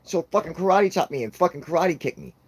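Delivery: ordinary delivery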